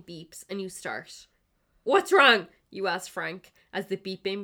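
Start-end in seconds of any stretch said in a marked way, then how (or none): 1.8-2.5 alarmed. Acted. Sudden alarm at beeping machine.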